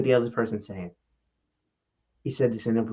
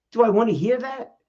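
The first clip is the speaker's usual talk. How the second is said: normal talking voice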